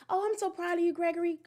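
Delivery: high, sing-song empty praise; generic praise. Shown as a demonstration. Mother giving her son shallow praise, high sing-song. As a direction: gush without meaning it. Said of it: "I'm not just".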